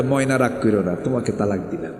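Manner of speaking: low, soft sweet-talk; mock lovingly. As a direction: coax a darling gently, tongue in cheek. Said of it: "মহব্বত করে তালাক দেয়".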